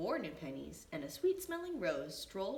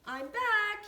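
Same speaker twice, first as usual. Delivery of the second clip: very high, loud call home; 11 dB louder than usual. A narrator's reading. Benny calls home to his family, high and loud. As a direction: call out happily from the door. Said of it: "he called".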